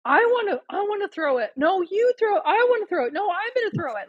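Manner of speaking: high, whiny back-and-forth bickering; imitated squabbling kids. Boys arguing over who throws it, imitated high-pitched squabble. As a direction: mimic two boys fighting over a turn. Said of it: "they're arguing back and forth".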